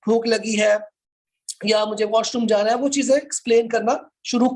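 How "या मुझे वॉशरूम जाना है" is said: ordinary delivery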